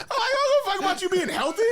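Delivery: high, laughing rant. Retold or imitated. Mock fan to the singer, laughing high. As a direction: laugh through the line.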